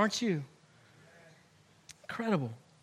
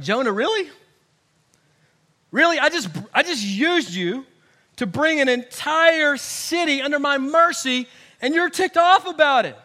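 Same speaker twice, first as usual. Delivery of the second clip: louder, higher mock-incredulous rebuke; sarcastic God; 5 semitones higher, 6 dB louder than usual. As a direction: needle Jonah like a teasing father. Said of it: "sarcasm even from the Almighty".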